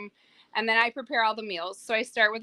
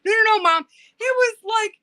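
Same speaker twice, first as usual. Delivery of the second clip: much higher and louder, eagerly insisting; insistent excited kid; 14 semitones higher, 7 dB louder than usual. Retold or imitated. Son insisting to mom that the turtle was huge, high and excited. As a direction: insist like a thrilled boy who is sure he saw a giant. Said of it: "He goes".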